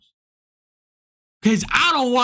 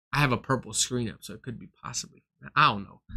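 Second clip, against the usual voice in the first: muttered aside; 10 semitones lower, 8 dB quieter, faster than usual. Real, not acted. Muttered aside about screen.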